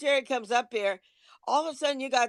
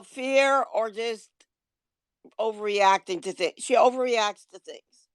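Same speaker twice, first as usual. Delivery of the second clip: ordinary delivery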